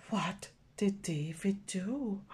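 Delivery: usual speaking voice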